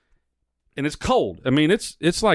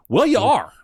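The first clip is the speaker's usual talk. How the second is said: higher, louder comeback; snapping back; 8 semitones higher, 4 dB louder than usual. Retold or imitated. Answering the rude driver at the pump, louder retort. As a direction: fire the retort straight back. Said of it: "I'm like".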